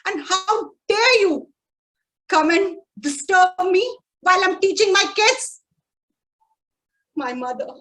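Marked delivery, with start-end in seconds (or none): 0.1-5.6 loud, high angry shout with a catch; furious father. Retold or imitated. Father shouting at mother for disturbing lessons. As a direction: rage at being interrupted. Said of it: "my father got so furious and said".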